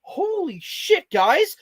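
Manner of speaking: slow, high amazed exclamation; excited. Real, not acted. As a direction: drag out the amazement. Thrilled at finding paper bags.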